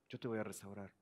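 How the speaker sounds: whispered promise. Acted. Repeated softer.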